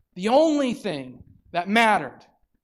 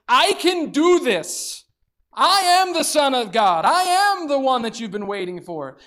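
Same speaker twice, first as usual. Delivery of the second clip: bold declaration; 7 semitones higher, 4 dB louder than usual. Retold or imitated. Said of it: "pointing at himself and going".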